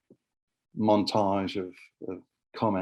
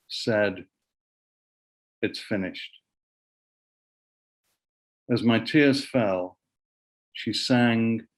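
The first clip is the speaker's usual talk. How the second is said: poetry reading. Acted. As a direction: read the poem line by line, letting each phrase hang. Recites own poem about late partner.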